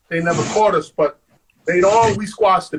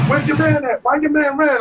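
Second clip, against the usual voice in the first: higher-pitched mock cop questioning; cop demanding answers; 6 semitones higher than usual. Cop questioning him about his runaway friend, demanding. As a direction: fire questions like an officer.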